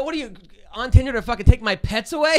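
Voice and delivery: half-laughing retold rant at the Tinder girl; indignant outburst. Comic retells scolding his date, loud mock fury. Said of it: "I go".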